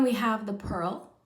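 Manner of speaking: ordinary talk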